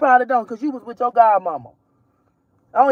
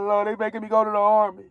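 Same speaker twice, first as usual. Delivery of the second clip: lightly whiny, fast mock son whining "Oh, Lord"; quick mock wail of a scared son; mock crying son; 4 semitones lower, 5 dB quieter, faster than usual. Mother mocking her son calling her crying, hint of a whine. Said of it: "he'll call me crying".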